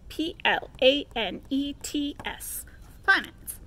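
emphatic spelling; spelled out. Acted. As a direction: spell it letter by letter to rub it in. Spelling out angrily.

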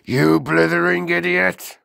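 high, loud, furious headmistress; outraged bellow; shouted insult. A narrator's reading. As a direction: yell it at a hopeless pupil. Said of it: "shouted the Trunchbull".

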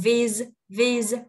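"Vis" said as "viz", ending in a buzzed Z; emphatic, separate "Vis"; voiced Z ending. Shown as a demonstration. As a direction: close "Vis" on a held Z sound. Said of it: "Âm Z vô".

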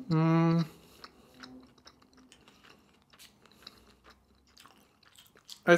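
chewing while speaking; talking around food; mouth full. Real, not acted. Seen 4: puts roll in mouth at 2.5s, then chews through "Mm.

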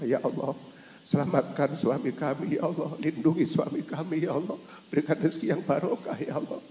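a hint of soft pleading prayer. Preacher voicing a pious wife praying for her husband.

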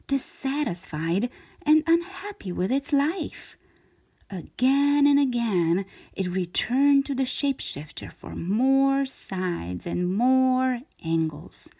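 usual speaking voice

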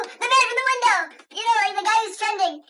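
high, loud exasperated explanation; worked-up, high-pitched insistence; frantic insisting. Pretend. Insists to disbelieving players.

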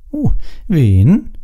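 put-on high, nosy girl question; pry pointedly, pitched well up; pointed curiosity, high voice. A narrator's reading. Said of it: "fragte sie spitz".

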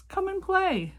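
high, lightly barked fox call; barked invitation. A narrator's reading. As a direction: toss out a high, eager invitation. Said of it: "barked Fox".

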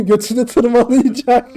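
normal talking voice

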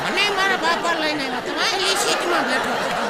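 put-on high cheeky kid, half laughing; child voice. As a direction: tattle like a naughty boy. Schoolboy tells on teacher. (શિક્ષકની પોલ ખોલતો છોકરો, ઊંચા અવાજે)

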